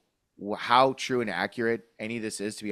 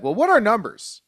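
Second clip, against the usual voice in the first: mock diehard fan; 11 semitones higher, 7 dB louder than usual. Mocking diehard fan reasoning.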